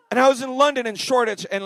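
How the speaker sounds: usual speaking voice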